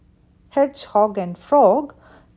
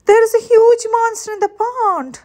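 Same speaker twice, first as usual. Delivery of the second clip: high, fast, frightened cry; 11 semitones higher, 5 dB louder, faster than usual. Acted. Frog telling friends about the monster, high frightened cry. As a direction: blurt it out in a panic. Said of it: "he cried".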